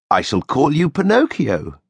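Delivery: put-on kindly old woodworker naming his puppet; Geppetto. A narrator's reading. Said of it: "Geppetto said".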